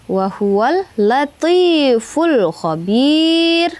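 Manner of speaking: "Wa huwal latiful khabir" recited slowly with long held vowels; slow Quran recitation. Shown as a demonstration. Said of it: "Ti itu apa namanya?". (bacaan "Wa huwal latiful khabir" pelan dengan mad panjang)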